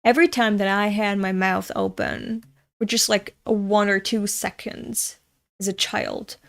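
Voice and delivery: ordinary talk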